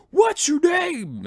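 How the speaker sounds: slow, high, loud shouted demand; sergeant major yell. Acted. Sergeant major challenges soldier in barracks, drawn-out yell. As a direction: bellow it slowly like a drill-ground challenge. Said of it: "yell at the knuckleheads".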